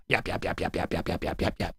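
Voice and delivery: soft, whispered yapping; mock yapping. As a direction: yap softly like a tiny dog, mocking. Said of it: "yapping like a little fucking chihuahua".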